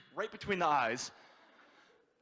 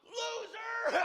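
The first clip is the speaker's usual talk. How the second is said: very high, drawn-out jeer; heckling shout. Retold or imitated. Construction worker heckling the comic, high mocking shout. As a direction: holler it like a bar heckler.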